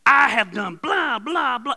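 loud, slow, indignant mock tirade; angry wife quote. Sick wife venting at her husband, loud and angry. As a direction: hammer out each blah as a complaint. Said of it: "blah, blah disrespectful".

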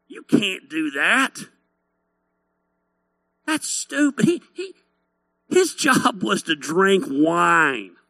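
slow, drawn-out hillbilly scorn; hillbilly critic. Acted. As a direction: drawl it like a dismissive hillbilly. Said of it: "Bunch of hillbillies criticizing Nehemiah".

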